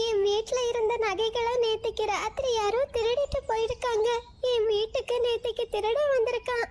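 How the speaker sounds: high, tearful bird voice; small bird telling friends about the theft, high and upset; upset little bird voice. Acted. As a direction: squeak it out in distress. Meenu tells of the theft.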